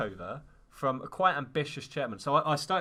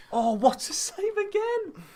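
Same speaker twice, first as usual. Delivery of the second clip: excited exclamation; 7 dB louder, slower than usual. Real, not acted. Sudden save during sales pitch.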